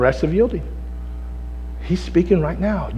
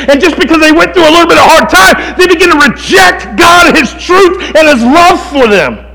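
high, loud pulpit preaching; impassioned preaching; 9 semitones higher, 9 dB louder than usual. Acted. Preacher hammering Scripture home to the congregation, full-force preaching. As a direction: preach it hard, punching key words.

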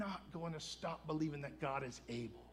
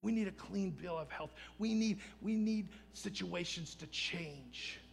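ordinary delivery

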